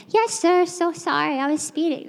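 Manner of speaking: high puppy voice. Shown as a demonstration. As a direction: voice jumped way up to seem small. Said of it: "my voice goes up a couple octaves".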